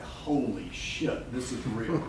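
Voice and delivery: slow, low, awestruck murmur; quiet, stunned realisation; hushed disbelief. Retold or imitated. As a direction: let it sink in quietly and slowly. Stunned at first online money.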